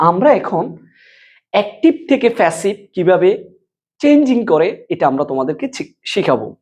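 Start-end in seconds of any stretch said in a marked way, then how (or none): none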